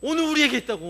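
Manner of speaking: 우리에게 cried out loud and high; loud proclamation on 우리에게; proclaiming loudly. Acted. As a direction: shout 오늘 우리에게 있다고 to the hall. Preacher proclaims, then repeats softly.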